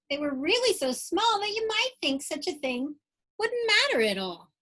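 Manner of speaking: lively rhyming storybook read-aloud; bouncy Dr. Seuss-style read-aloud; reading to children. Acted. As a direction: read it like bedtime story time for kids. Reads picture book aloud.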